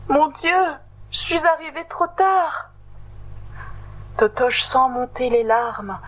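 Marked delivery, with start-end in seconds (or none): 0.1-2.7 higher, dismayed lament; despairing cry. Acted. Totoche fearing he lost Meredith, high and dismayed. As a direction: fear you came too late.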